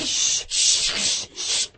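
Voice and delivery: soft, drawn hushing noise; shushing. A narrator's reading. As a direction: hush someone who is objecting. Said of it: "the Hatter and the March Hare went".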